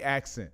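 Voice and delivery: "accent" pronounced "ax-in"; axin' for asking. Shown as a demonstration. Host insisting to viewers on the wording, axin' for asking. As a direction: say asking as "accent", k before s, final g dropped. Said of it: "We ain't asking".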